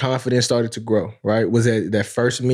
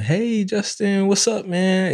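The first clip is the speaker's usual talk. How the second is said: high, drawn-out friendly hail; fawning greeter; 9 semitones higher, slower than usual. Acted. People suddenly greeting the rising athlete, high drawn-out hail. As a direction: stretch it out in a raised, singsong voice.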